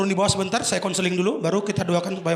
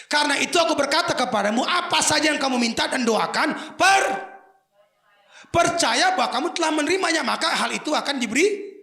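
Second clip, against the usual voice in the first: loud, raised preaching read; scripture reading; 6 semitones higher, 6 dB louder than usual. Acted. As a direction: proclaim the verse to the hall. Reads scripture aloud. (membaca ayat dengan suara lantang berkhotbah)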